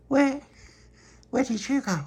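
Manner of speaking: put-on high curious child; high kid voice. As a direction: ask like a small kid. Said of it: "And he went".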